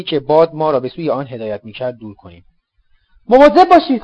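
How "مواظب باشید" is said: high, loud cry of alarm; urgent warning shout. Acted. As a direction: yell the warning over the wind. Escape under gunfire, warning cry. (فریاد هشدار بلند و زیر)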